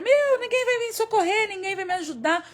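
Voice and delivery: high-pitched exasperated cry, easing off; worked-up outburst. Acted. Overwhelmed woman complaining nobody will help, worked-up outburst. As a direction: burst out high, acting someone losing it. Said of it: "tem hora que a gente se exalta assim".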